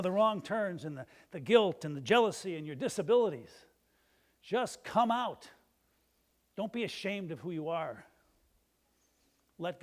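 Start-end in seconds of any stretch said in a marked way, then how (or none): 4.4-5.5 louder, slow, higher call; forceful call. Real, not acted. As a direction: call them out with full conviction. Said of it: "invitation repeated with force".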